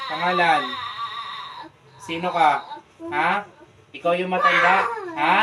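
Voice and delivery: loud, demanding questioning; stern interrogation. Real, not acted. Healer interrogates the spirit in child. (pasigaw na pag-uusisa)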